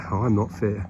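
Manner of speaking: normal talking voice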